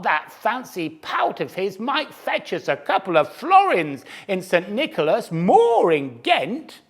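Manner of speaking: high, loud merchant voice; gruff merchant shouting. Acted. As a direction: bellow it to your mate on the road. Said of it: "he called".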